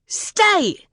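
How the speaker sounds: high-pitched, urgent command; one long, high yelled command; terrified roar. A narrator's reading. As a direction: cry out the order to the dog in terror. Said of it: "Kate roared, a shaft of pure terror".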